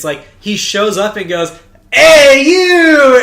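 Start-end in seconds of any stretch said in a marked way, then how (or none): 1.9-3.2 high, loud hammed-up shout of hey you; mock-excited teacher yelling hey you; mock loud greeting. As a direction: imitate the teacher's overeager greeting. Teacher greets Shoichi in story.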